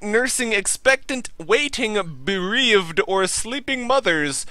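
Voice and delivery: mock pharmaceutical ad voice; drug ad read. Reads drug ad copy aloud.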